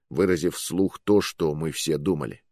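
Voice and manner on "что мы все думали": usual speaking voice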